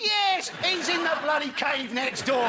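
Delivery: high, emphatic burst of recognition; drawn-out punchline outburst; exasperated eager reply. Acted. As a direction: burst out with the obvious answer, then slow down. Acted answer by villager, joke's punchline.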